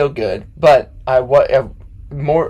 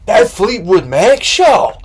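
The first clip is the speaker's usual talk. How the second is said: slow, loud, higher gushing fan voice; drunk fan impression; 6 semitones higher, 7 dB louder, slower than usual. Fan telling the band about the show, slow loud gush. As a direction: ham up the excited concert-goer, drawn out. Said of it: "I'm doing a perfect impression right now".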